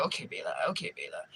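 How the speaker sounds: low, soft Arabic-accented boss imitation; imitated Arabic-accented boss. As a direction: drop low and quiet, mimicking the boss's Arabic accent. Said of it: "he's Arabic or whatever".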